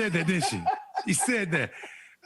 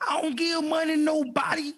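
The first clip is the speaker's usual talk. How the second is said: Midwest accent; 7 semitones higher than usual. Real, not acted. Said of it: "I love your Midwest accent".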